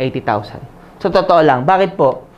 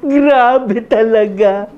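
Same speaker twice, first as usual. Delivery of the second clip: slow, stretched-out amazement, a touch higher; lightly voiced friend, slow and higher; awestruck friend; 6 semitones higher, much slower than usual. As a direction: drag it out like a dazzled buddy. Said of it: "sabi niya".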